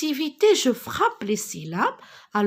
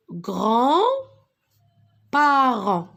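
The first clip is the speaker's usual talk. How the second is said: grands-parents stretched very slowly and louder; slow syllables; 4 dB louder, much slower than usual. Shown as a demonstration. Teacher reading the sentence for pupils, very slow. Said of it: "la petite fille".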